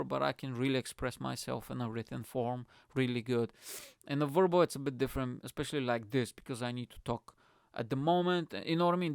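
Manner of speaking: ordinary talk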